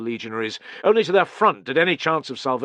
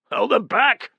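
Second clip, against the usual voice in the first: incredulous soldier; 12 semitones higher, 7 dB louder than usual. Acted. Nocrest incredulous.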